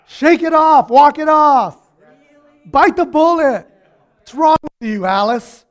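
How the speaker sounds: high, loud, rough-teasing shout; loud mocking bench yells; bench guys yelling. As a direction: holler like teammates from the bench. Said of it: "What are the guys from the bench gonna say?".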